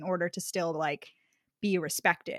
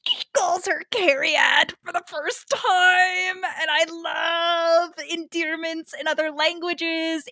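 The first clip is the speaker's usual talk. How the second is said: high, loud, drawn-out gush; gushing delight; 7 semitones higher, 4 dB louder, slower than usual. Real, not acted. Fan telling of the hero's first endearment, gushing excitedly. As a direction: swoon over it, stretching words high.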